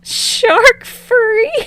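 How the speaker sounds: high shrieked disbelief, slow; yelling. Acted. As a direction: yell it out in shock. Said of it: "Bakugo yells".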